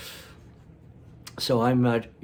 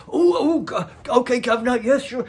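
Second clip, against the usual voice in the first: high, loud, flustered deference; servile old man; 13 semitones higher, 8 dB louder than usual. Retold or imitated. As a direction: fawn like a bowing, scraping old fellow. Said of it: "He's all bowing and scraping".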